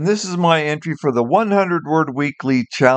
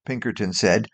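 ordinary delivery, nothing marked

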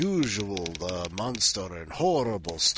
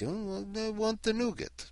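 high, soft pampered whine; mock princely pleading in a vampire accent; spoiled prince; 12 semitones higher, 6 dB quieter than usual. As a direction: put on a high little prince voice. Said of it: "you live your life as a prince".

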